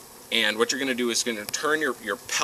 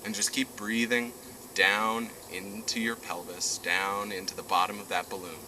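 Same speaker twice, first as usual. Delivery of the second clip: guided meditation; 7 dB quieter than usual. Acted. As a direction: ease them down into calm.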